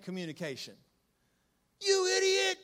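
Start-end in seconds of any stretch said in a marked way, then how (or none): 1.8-2.6 mock insult. Quoting insults people say.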